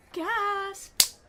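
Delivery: very high spoken mock gasp; mock shock. Streamer teasing Nikolai that some people care, mock shock. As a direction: squeal the word in pretend shock.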